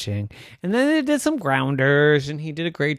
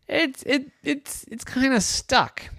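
put-on hesitant boy; 12 semitones higher than usual.

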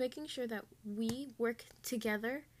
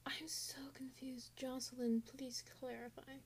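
whispering to classmate Jocelyn; confused whisper; 2 semitones higher, 9 dB quieter than usual. Real, not acted. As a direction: whisper so the teacher won't hear. Quietly asking classmate for help.

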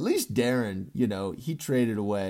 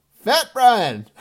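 high, loud teasing shout; gleeful teasing yell; shouted nickname; 10 semitones higher, 12 dB louder than usual. Real, not acted. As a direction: yell the name out laughing.

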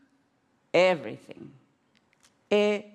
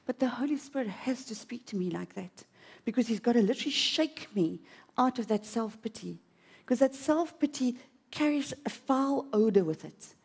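ordinary talk

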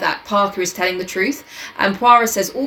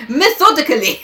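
put-on higher, punched French-accented "Methodically"; triumphant retry; 7 semitones higher, 5 dB louder than usual. A narrator's reading. Detective's stumbled word redone, "Methodically" proudly higher. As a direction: land "Methodically" with an accented flourish.

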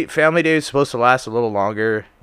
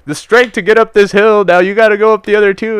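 loud, high, driving exhortation; preaching chaplain; 9 semitones higher, 8 dB louder than usual. Retold or imitated. Chaplain urging recruits up the other hills, mock fervor. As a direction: keep up the hammed-up chaplain rally. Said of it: "he's like".

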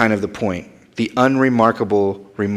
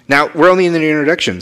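higher, louder and fast; emphatic reminder; 6 semitones higher, 6 dB louder, much faster than usual. Real, not acted. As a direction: push it with energy. Stresses the point energetically.

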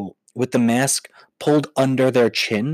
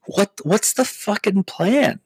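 higher, slow incredulous voice; exasperated mock; 8 semitones higher, slower than usual. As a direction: drag out the disbelief. Mocking exasperated question.

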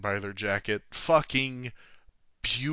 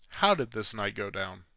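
ordinary delivery

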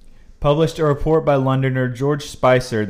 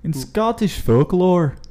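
put-on hesitant Scottish accent, higher and slowed x0.6 on "Scottish folklore"; attempted Scottish accent; 6 semitones higher, slower than usual. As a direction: stretch "In Scottish folklore" out in a halting try at a Scottish accent. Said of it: "Can you do this in a Scottish accent?".

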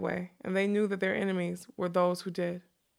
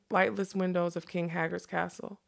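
plain reading voice

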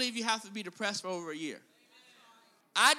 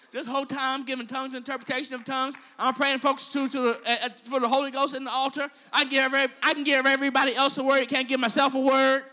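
loud fast preaching climax; 3 semitones higher, 9 dB louder, faster than usual. Acted. Preacher building to a climax, fast and loud. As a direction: drive it home, fast and loud.

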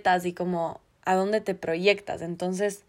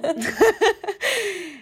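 high laugh; 10 semitones higher than usual. Real, not acted. Laughing at her own fun fact.

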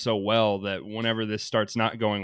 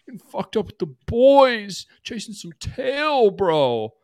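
much higher, slow put-on party bro; frat bro voice; 10 semitones higher, slower than usual. Mocking the forever-in-college guy, put-on frat bro drawl. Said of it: "he's the forever in college guy".

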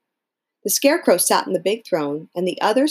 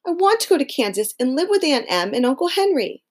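put-on high little-girl voice, insisting; a girl who wants to go home; Dorothy pleading; 8 semitones higher than usual. Dorothy tells friends she wants Kansas, high girl voice. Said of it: "cried Dorothy".

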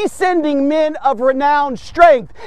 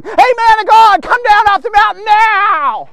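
loud, higher jeering call up the mountain; mocking shout; 7 semitones higher, 5 dB louder than usual. Captain jeering Elijah to come down off the mountain, loud mockery. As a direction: hurl the title up at him in scorn. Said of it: "They're mocking him when they say".

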